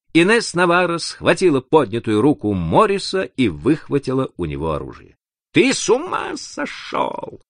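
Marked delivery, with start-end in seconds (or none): 5.5-7.4 high, slow, strained hiss; narrator's high-pitched woman hissing. A narrator's reading. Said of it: "прошипела она".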